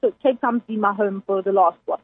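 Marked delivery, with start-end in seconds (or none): none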